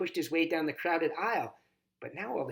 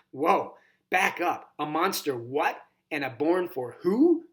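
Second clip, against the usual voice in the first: slow, louder, higher disbelief; flabbergasted; 4 semitones higher, 6 dB louder, slower than usual. A narrator's reading. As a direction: stretch each question out in shock.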